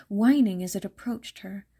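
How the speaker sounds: ordinary delivery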